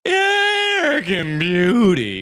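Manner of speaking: slow, shouted mock announcer. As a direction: boom it out like an announcer. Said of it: "what you think an announcer voice is".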